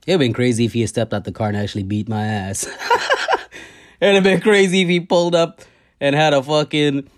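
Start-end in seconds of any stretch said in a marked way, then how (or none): 2.7-3.6 a quick airy high laugh; breathy laugh. Real, not acted. Laughing at the idea of getting beaten up, real laugh. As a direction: laugh at the thought of it.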